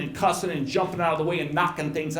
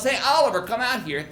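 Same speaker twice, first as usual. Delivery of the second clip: yelling; 6 semitones higher, 5 dB louder than usual. Retold or imitated. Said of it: "one of the fellas yells".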